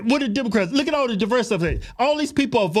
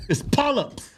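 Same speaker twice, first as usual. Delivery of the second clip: normal talking voice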